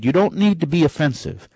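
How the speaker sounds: usual speaking voice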